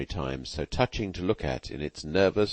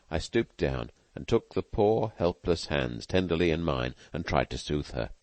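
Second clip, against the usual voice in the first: usual speaking voice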